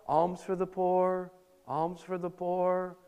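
a lightly voiced weary beggar chanting slowly; mild, slow, passionless beggar's chant; monotone begging call. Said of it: "doing it in a monotone, kind of impassionate way".

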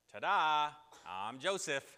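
ta-da reveal. Acted. Joseph revealing himself to brothers.

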